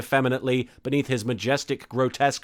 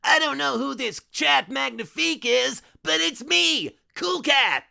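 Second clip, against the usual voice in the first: cheesy cartoon cat mascot, proudly; cartoon mascot hero; 13 semitones higher than usual. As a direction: announce yourself like a kids' TV cat hero.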